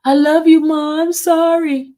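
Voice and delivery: high, pleading daughter's voice, loud then fading; tearful apology. Retold or imitated. Mother voicing her adopted daughter's tearful apology from court, high and pleading. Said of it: "she was like".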